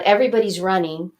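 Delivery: ordinary delivery, nothing marked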